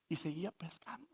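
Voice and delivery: ordinary talk, nothing marked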